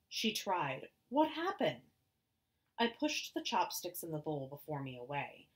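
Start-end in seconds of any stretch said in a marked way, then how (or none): 1.1-1.8 worried. Acted. Mother asks, alarmed.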